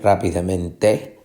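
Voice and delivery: rápidamente with final TE stressed and rising; stress on last syllable. Shown as a demonstration. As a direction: wrongly land the weight on TE in rápidamente. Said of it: "la fuerza de pronunciación no está aquí". (rápidamente con acento final en TE)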